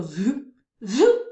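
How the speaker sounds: a magic zap sound; wand zoop. A narrator's reading. Narrator voices the father's wand making things vanish.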